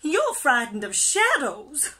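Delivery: high, loud, mocking lilt; giggly teasing. A narrator's reading. Pufferfish teasing his friend, high and amused. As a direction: poke fun at a scaredy friend. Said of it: "giggled Pufferfish".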